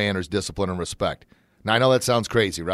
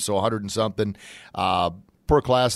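ordinary talk

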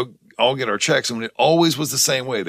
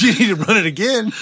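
laughing; 8 semitones higher, 4 dB louder than usual. Retold or imitated. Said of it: "laughing while quoting himself".

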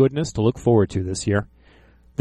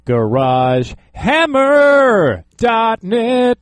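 slow shout; 10 semitones higher, 4 dB louder, much slower than usual. Acted. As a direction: drag out the website as a big slow shout. Shouting the website.

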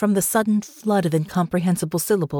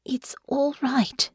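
slow, high, whispery comfort; breath-soft reassurance; soft and soothing; 7 semitones higher, 3 dB quieter, slower than usual. A narrator's reading. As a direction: hush someone tenderly, almost whispering. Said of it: "soft and soothing now".

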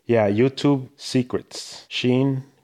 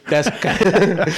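laughing; 8 semitones higher, 4 dB louder, much slower than usual. Real, not acted. Laughing after flag joke.

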